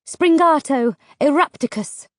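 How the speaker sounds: commanding spell, called out; spell incantation. A narrator's reading. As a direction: cast it with force. Casting a spell.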